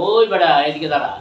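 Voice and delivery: loud, joking street call. Acted. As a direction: holler at some fellow to stop. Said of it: "আমরা বলবো". (জোরে ডাক দিয়ে)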